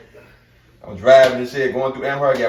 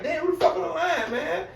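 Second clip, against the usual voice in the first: irritated disbelief; 4 dB quieter than usual. Retold or imitated. Irritated at phone call.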